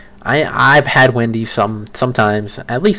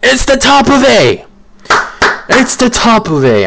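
high, loud, mock-dramatic proclamation; hyped-up announcement; 13 semitones higher, 6 dB louder than usual. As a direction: shout the verdict in a very high pitch.

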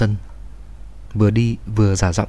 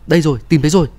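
raised, high-pitched urgent call; 6 semitones higher, 4 dB louder, much faster than usual. A narrator's reading. First rescuer tells others he has found her, urgent high call. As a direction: call out the find to everyone. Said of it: "đã kêu lên".

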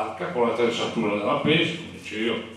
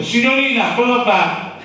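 calling out; 9 semitones higher, 6 dB louder than usual. Retold or imitated. Officer calling out to the girl.

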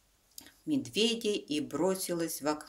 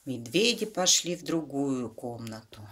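usual speaking voice

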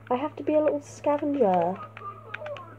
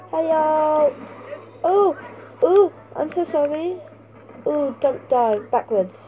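normal talking voice

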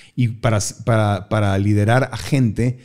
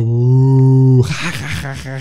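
mock class laughter; 2 semitones higher, 4 dB louder, much slower than usual.

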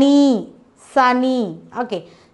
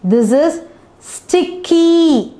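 "sticky" drawn out higher and slower; slow emphatic reading; 5 semitones higher, 3 dB louder, slower than usual. Shown as a demonstration. Teacher introducing the new word sticky, slow and emphatic. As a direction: present "This is sticky" slowly to the class. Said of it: "teacher presenting new word".

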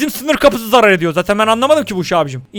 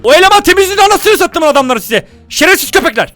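much higher, loud angry yelling; furious shout; 9 semitones higher, 5 dB louder than usual. Real, not acted. Streamer rages at his guards, furious shout. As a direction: explode with rage at the game. Furious after losing money.

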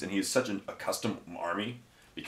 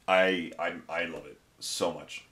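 usual speaking voice

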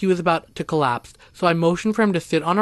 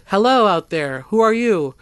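narrated call into the distance, louder and higher; yelled; 5 semitones higher, 5 dB louder than usual. A narrator's reading. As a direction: call out to a stranger, moderately raised voice. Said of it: "I instinctively yelled".

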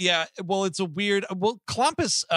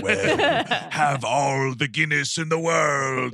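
slow, loud put-on villain voice; mock villain taunt; 3 dB louder, much slower than usual. Podcaster plays Jigsaw punishing the Leprechaun, slow mock taunt.

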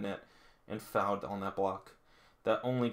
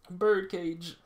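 excited callout; 8 semitones higher, 5 dB louder than usual. Real, not acted. Calls out the move name.

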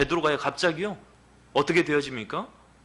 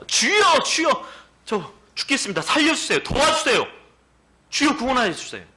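high, loud, pleading shout for rescue; a frantic, earnest wail for help; desperate cry; 12 semitones higher, 5 dB louder than usual. Shown as a demonstration. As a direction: cry out to the Lord like a drowning man. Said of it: "간절하지 않았겠습니까?".

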